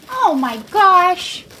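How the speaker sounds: slow, loud delighted gush. Real, not acted. Owner gushing over her rats, slow and loud. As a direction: stretch it out in delight.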